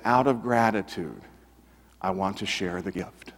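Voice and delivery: low, soft, heartfelt answer with pauses; quietly sincere. Retold or imitated. Former professor answers reporter why he became pastor, quietly sincere. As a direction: let it sink almost to a hush, humbly retold.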